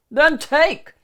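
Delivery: high loud shriek. Acted. Shrek ordering the donkey to go, high shriek. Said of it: "Shrek shrieked".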